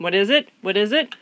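teacher cooing at a farm animal mid-lecture; pet talk. Real, not acted. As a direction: ask the animal what's up, sing-song. Teacher distracted, coos at an animal.

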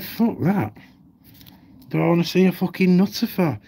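lightly voiced doctor refusing; dismissive doctor. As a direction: toss it off like a fed-up doctor. Voicing the doctor's dismissive reply.

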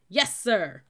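very loud recruit's reply; shouted response. A narrator's reading. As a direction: shout it to shake the stadium. Recruits' stadium-shaking reply.

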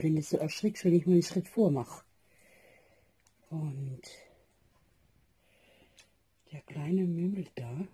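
drowsy, soft early morning voice; barely awake, murmuring at dawn; sleepy voice. Real, not acted. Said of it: "entschuldigen für die schläfrige Stimme". (verschlafene Stimme)

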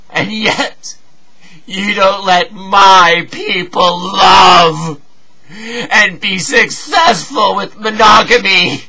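anguished accusing cry; tearful reproach. Acted. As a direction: protest through sobs, stretching the words.

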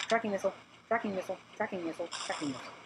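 quick-fire chant fading away; rapid repetition. Real, not acted. Repeats weapon name to liven play.

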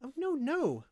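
high, soothing character voice. A narrator's reading. Windling reassuring the frightened slave, soothingly. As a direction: hush her worries, pitched high. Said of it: "Linthus told her soothingly".

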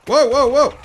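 loud, high rapid-fire whoas; startled. Real, not acted. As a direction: cry out in alarm. Startled in combat.

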